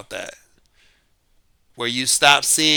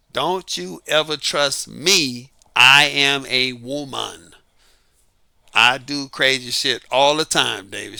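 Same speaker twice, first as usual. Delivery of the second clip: very slow, deliberate motherly warning; mother warning her son, said very slowly; mother's slow warning; slower than usual. Retold or imitated. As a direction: lay each word down slowly like a mom's lesson. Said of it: "She said it just like that".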